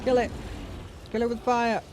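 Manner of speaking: high excited yell; rally the team, shouting; battle cry. Real, not acted.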